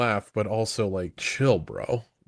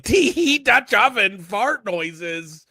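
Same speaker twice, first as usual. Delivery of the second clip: put-on high, loud childish "tee hee"; childish giggle; 7 semitones higher, 4 dB louder, slower than usual. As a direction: giggle like a silly kid. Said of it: "I'm such a child".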